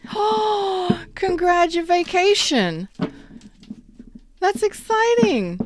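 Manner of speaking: high, slow, gushing congratulations; delighted high gasp then congratulations; gasping excitement. Real, not acted. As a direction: gasp and gush the good news.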